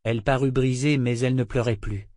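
plain reading voice

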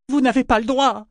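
high, loud protest; outraged exclamation. A narrator's reading. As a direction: exclaim in shrill-pitched indignation. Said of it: "s'exclama Kefria". (exclamation indignée)

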